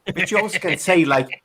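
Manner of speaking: ordinary delivery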